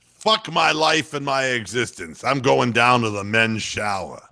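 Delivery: mock despair of a gruff commentator, slow and drawn out; Tommy Heinsohn impression. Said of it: "I wonder if Tommy would have said".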